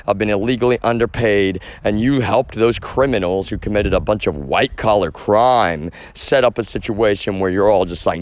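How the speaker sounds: normal talking voice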